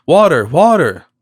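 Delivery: loud desperate wail for water; wailing plea. A narrator's reading. Dying miser wailing to a stranger for water. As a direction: cry out for water, parched and pleading. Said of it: "a wail like that of Dives is heard".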